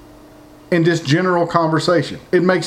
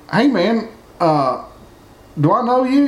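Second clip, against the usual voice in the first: higher, friendly mock greeting to a stranger; stranger's greeting; 6 semitones higher than usual. Stranger at the mall greeting someone, lightly mocked. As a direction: play a guy greeting someone he half-knows. Acted small talk at the mall.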